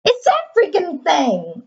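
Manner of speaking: drawn-out "It's that freaking thing", slow with "that" leaned on. Real, not acted. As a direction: drag it out in disbelief. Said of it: "repeats the line differently".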